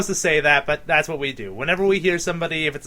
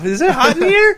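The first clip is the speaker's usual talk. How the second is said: flustered; 12 semitones higher, 9 dB louder than usual. Retold or imitated. Flustered by customer's voice.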